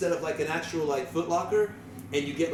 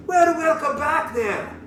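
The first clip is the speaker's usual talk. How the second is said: louder, slow booming welcome; loud barkeep greeting; 9 semitones higher, 5 dB louder, slower than usual. Acted. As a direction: boom out the welcome. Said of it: "Why are you yelling?".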